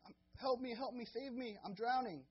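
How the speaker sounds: high, loud cries for help; a pretend drowning man yelling from the river; mock drowning yell. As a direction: call out like a drowning man, hammed up. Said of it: "drowning and yelling".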